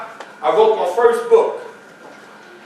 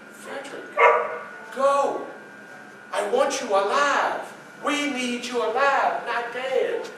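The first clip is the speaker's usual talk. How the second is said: much softer, urgent plea to her husband; soft pleading; 3 semitones higher, 9 dB quieter than usual. Acted. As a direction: beg him gently but firmly to go. Wife urging him to flee.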